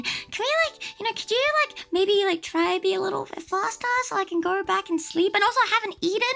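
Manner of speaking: high, sugary polite request; overly polite. Retold or imitated. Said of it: "I was still being nice".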